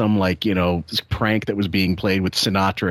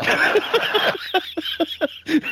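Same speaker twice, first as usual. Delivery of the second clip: high-pitched burst of real laughter; helpless laughter. Real, not acted. Streamer cracking up at a comic's story, helpless laughter.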